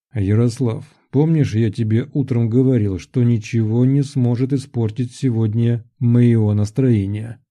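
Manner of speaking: usual speaking voice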